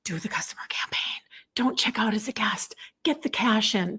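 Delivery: hushed conspiratorial whisper; playful stage whisper; mock-secret whisper. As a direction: whisper it like an insider tip. Mock-secret sales instructions.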